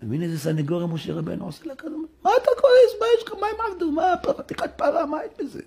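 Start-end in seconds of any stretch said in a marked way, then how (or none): 2.2-5.6 pleading Moses. Acted. Moses pleads with God in a high, coaxing voice. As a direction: brush off the sin in a high, coaxing voice.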